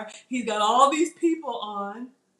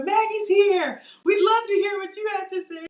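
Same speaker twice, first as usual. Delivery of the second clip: eager welcome; 10 semitones higher, 4 dB louder than usual. Retold or imitated. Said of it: "in the chat like".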